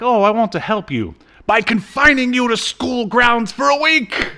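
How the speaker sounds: high, loud, smug fake-kindly dean voice; mock dean. Mocking the dean who confines Bond to school, high and loud. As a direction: ham up the dean's fake helpfulness. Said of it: "He's like".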